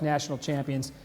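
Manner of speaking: ordinary delivery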